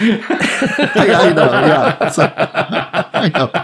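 high laughing I know. Real, not acted. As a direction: giggle through the words. Laughing at poet rulers.

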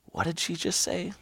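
soft, high whispered aside; whispering. Retold or imitated. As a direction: whisper to your friend. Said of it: "Toby whispered to me".

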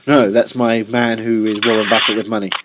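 normal talking voice

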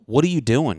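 loud, mildly exasperated scolding; retold scolding. Retells yelling at his friend.

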